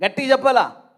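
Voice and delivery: loud, high prompt to the crowd; loud urging. Real, not acted. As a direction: push the congregation to speak up, loudly. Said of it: "కొంచెం గట్టిగా చెప్పండి".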